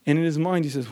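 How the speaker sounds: ordinary talk, nothing marked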